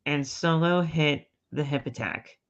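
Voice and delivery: ordinary delivery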